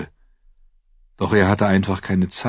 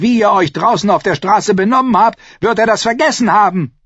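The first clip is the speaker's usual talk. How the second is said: scornful rage; 14 semitones higher, 7 dB louder than usual. Acted. Cersei mocks the knights.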